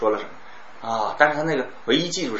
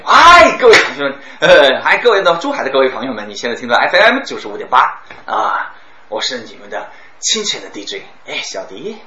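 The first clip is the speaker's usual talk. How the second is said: hyped radio DJ; 9 dB louder than usual. Shown as a demonstration. Radio DJ greeting his Zhuhai listeners, loud and excited. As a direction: fire up the listeners like a live daytime DJ. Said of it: "我就整个人就开始兴奋起来".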